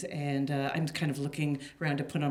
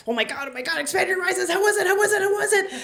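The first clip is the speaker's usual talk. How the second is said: high, loud, breathless excitement; bursting with excitement to hear the gossip; excited squeal; 5 dB louder, much faster than usual. Retold or imitated. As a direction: gush at her like a giddy teen. Excited friend pestering after night.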